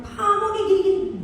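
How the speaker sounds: high-pitched imitation of scolding; mock public scolding. Wife telling off husband in front of people, mock scolding.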